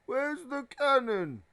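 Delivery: high, slow, loud dopey cry; stupid, just woken. Acted. Dudley jerked awake by the knocking, stupidly. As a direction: blurt it out dopily, drawing it out. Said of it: "he said stupidly".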